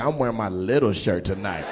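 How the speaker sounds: low, soft mock of the shirt guy. Comic voices the guy picking his little shirt, low and soft. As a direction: dip low and soft to play him smug. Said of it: "He was like".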